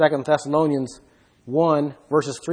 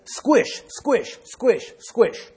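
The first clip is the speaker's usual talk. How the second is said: squishing sound; 4 semitones higher, much slower than usual. Retold or imitated. Mimicking grapes crushed underfoot.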